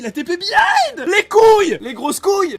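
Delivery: high, loud hype outburst; excited yelling. Real, not acted. Player amazed by a teleport behind, excited yelling.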